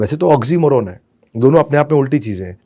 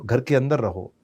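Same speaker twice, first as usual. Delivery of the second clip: softer, lower, gentle guidance; calm positive instruction; 3 semitones lower, 7 dB quieter than usual. Shown as a demonstration. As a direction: say it kindly instead. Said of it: "उसकी जगह बोलो".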